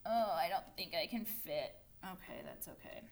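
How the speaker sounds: soft, low aside; muttered to self. Real, not acted. Teacher runs out of room while writing, muttering softly to herself. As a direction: mutter it low, trailing to almost nothing.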